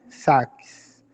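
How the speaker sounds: SAKS said just as it is spelled; pronounced as written. Shown as a demonstration. Teacher showing learners how Saks is said, pronounced as written. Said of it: "da mesma forma que se pronuncia".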